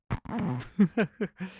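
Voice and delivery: short chuckle at own announcement. Real, not acted. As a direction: break into a small, wordless laugh. Jokes after revealing he has covid.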